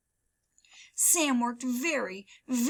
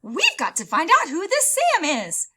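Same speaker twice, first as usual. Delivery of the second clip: eager librarian; 6 semitones higher, 8 dB louder than usual. Acted. Librarian determined.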